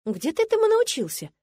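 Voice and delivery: very high, amazed question; genuinely surprised. A narrator's reading. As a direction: marvel at his skill. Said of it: "искренне удивившись".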